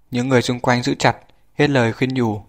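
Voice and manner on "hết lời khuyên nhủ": usual speaking voice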